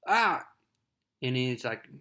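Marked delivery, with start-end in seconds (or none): 0.1-0.5 mock yelp. Spider-Man hit by sand.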